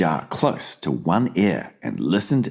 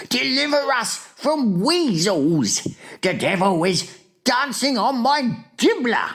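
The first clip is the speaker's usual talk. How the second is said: fury and anguish. A narrator's reading. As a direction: wail as if doomed. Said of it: "filled with fury and anguish".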